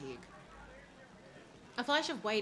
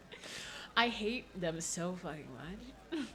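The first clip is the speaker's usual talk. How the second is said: ordinary talk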